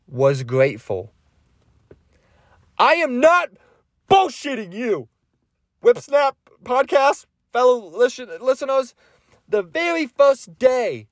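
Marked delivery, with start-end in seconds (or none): 2.8-11.1 worked-up, high and incredulous; amazed insistence. Real, not acted. Swears his story is true.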